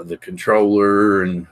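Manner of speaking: ordinary delivery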